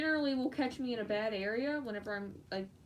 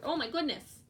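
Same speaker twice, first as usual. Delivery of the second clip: usual speaking voice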